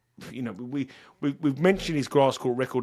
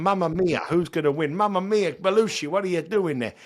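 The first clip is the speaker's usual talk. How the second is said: hammed-up Italian accent, higher and louder; mock Italian accent; 5 semitones higher, 4 dB louder than usual. Podcaster teasing Italian players in a mock Italian accent. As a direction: play a cartoon Italian accent for a laugh. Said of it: "That's my very good Italian accent".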